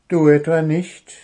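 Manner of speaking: high, loud baffled question; genuinely puzzled retort; surprised kobold. Acted. Kobold asks Puh, baffled. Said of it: "fragte Wuschel verblüfft".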